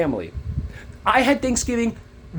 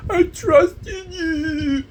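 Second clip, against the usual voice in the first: high, long-drawn mock whine; bratty seven-year-old taunt; mocking like a child; 8 semitones higher, much slower than usual. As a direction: jeer it back in a stretched kiddie wail. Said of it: "that's a seven-year-old".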